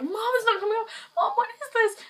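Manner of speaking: very high, loud squealed complaint to mom; whiny upset protest. Retold or imitated. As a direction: wail it at mom in a hammed-up high voice.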